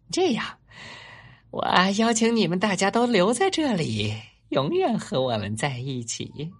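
high, loud fox voice, easing down at the end; excited Mr. Fox. Acted. Mr. Fox invites all the animals to stay forever, high and excited. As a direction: invite everyone grandly in a high-pitched voice.

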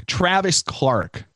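slow, reading the name out. Real, not acted. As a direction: spell out the name slowly. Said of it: "what is his name".

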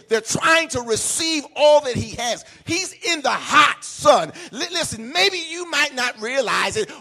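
loud, rising sermon cry; climbing preacher's shout; full-force preaching. Acted. Pastor building to a preaching peak.

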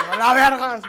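loud, high "¡A la verga!" shouted out; shouted curse. Shown as a demonstration. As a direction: blurt it out as a startled shout. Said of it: "yo puedo decir".

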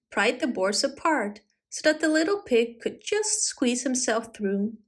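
plain reading voice

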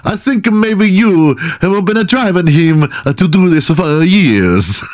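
high, slowish hammed-up Italian; mock Italian accent. Fake therapist blaming the cheated-on girlfriend, mock Italian accent. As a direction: lay on a badly faked Italian accent. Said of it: "I'm going Italian".

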